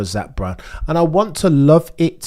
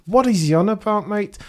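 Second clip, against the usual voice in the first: higher, incredulous bloke's voice; imitating Charles Edward; 8 semitones higher than usual. Host imitates his co-host Charles Edward, incredulous. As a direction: voice a mate going what's he on about. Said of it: "Charles Edward".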